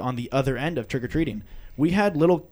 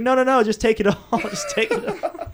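high, breaking into laughter; laughing insistence; 8 semitones higher than usual. Retold or imitated. Host urging kids to take all the candy, laughing insistence. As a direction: wave off objections in a high giggly voice.

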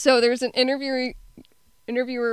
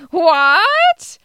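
loud, high, drawn-out rising question; Pavi; 8 semitones higher, 8 dB louder than usual. Retold or imitated. Quoting a silly movie character, exaggerated loud question. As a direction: blurt it out long and high. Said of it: "a part where Pavi says".

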